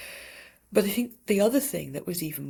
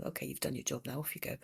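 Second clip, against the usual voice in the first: dismissive; 5 semitones lower, 9 dB quieter, much faster than usual. Retold or imitated. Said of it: "waves her fingers in that dismissive way".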